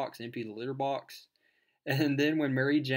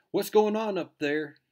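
raised, louder, higher mock call; aunt calling upstairs; 8 semitones higher, 8 dB louder than usual. Aunt to the teenagers upstairs, calling out suspiciously. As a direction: imitate an aunt hollering up to the kids.

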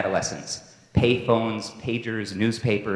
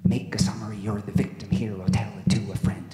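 whispered mantra; faster than usual. Acted. Father coaching pup to tell his story, whispered mantra. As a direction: let the refrain sink into a whisper.

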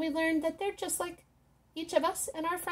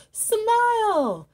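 much higher, louder burst of delight; excited reveal; 8 semitones higher, 7 dB louder than usual. Acted. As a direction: pop the answer out with glee. The big reveal to the kids.

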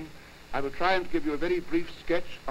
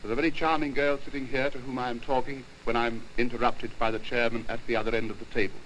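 normal talking voice

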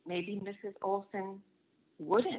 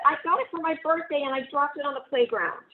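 put-on hurried upset boy; 7 semitones higher, 6 dB louder, faster than usual. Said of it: "cried Martin".

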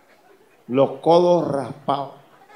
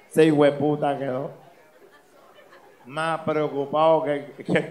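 usual speaking voice

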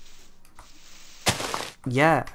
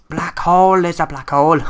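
excited shout; 5 semitones higher, 10 dB louder than usual. Real, not acted.

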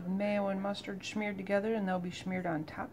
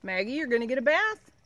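talking to the dog; 5 semitones higher, 8 dB louder than usual. Real, not acted. Telling the dog about bath.

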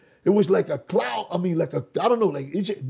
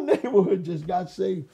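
usual speaking voice

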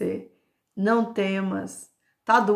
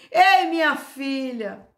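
Jesus; 6 semitones higher, 5 dB louder, slower than usual. Acted. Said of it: "Jesus aí fala pra mim assim".

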